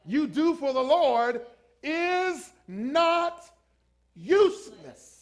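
slow, loud, word-by-word proclamation; forceful preaching. Real, not acted. Preacher driving the verse home to the congregation, slow and loud. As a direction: stretch and hammer each word.

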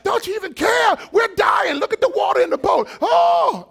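high, loud frantic crying out; panicked shout. Retold or imitated. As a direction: yell like you're drowning. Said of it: "they're like".

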